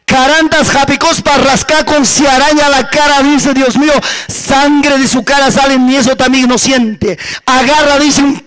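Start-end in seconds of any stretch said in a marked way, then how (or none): none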